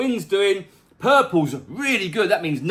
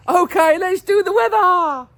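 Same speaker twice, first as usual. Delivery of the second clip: much higher, louder cartoon voice; squeaky character voice; 12 semitones higher, 6 dB louder than usual. Pet character introducing the weather, high and squeaky. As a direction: pipe up like a cheeky little pet.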